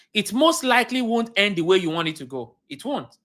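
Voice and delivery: usual speaking voice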